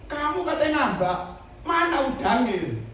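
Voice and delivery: high, loud retold challenge; acted incredulous questioning. Old colleague asking about the shrimp farm, acted incredulity. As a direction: imitate someone demanding an answer.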